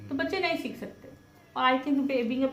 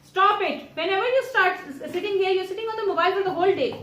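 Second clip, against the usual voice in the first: loud, raised-pitch telling-off; exasperated scolding; 3 semitones higher, 5 dB louder, much slower than usual. Real, not acted. Mother telling off child who avoids homework, exasperated scolding. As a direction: scold at full voice, drawing out the complaint.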